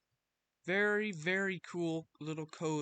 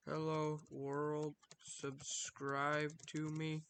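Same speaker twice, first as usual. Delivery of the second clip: slow, soft typing-along voice; slow dictation; 2 semitones lower, 8 dB quieter, much slower than usual. Shown as a demonstration. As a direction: say it slowly as you type it. Reads text while typing it.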